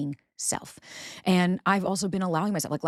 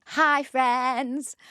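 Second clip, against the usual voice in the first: high bright hello; chirpy greeting; 8 semitones higher, 4 dB louder than usual. Acted. Host greeting friends at the episode start. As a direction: greet them sweetly.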